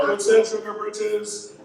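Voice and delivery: high, slow mock heckler voice; teasing audience member. Audience member teasing the comic after the set, mock high voice. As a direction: draw it out high, imitating a nosy fan. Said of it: "after the set, you're like".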